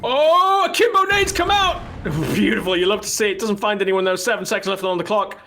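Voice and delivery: high, loud outburst that stays keyed up; excited play-by-play. Real, not acted. Caster calling grenades with seconds left, excited. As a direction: burst out loud, then keep the pitch up.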